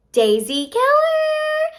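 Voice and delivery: high, loud, drawn-out name reveal; slow, high-pitched excited cheer; excited announcement. Real, not acted. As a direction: stretch the name out high like a fanfare. Announcing number two on the list.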